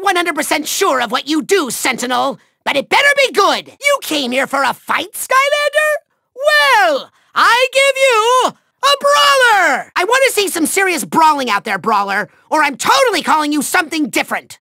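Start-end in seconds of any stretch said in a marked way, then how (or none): 5.1-9.9 high, drawn-out showman's reveal; grand villainous announcement. Acted. Villain unveils his brawler to the Skylander, grand announcement. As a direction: present the fighter like a ringmaster.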